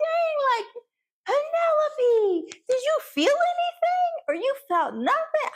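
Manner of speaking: stunned high call to Penelope; shocked high cry. Retold or imitated. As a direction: shout the disbelief at her.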